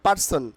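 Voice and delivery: "person" said par-son with a sounded r; the wrong "person", plain p and r in "par"; common mispronunciation. Shown as a demonstration.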